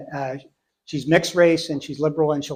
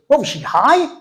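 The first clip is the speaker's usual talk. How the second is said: incredulous daughter; 9 semitones higher, 5 dB louder than usual. Retold or imitated.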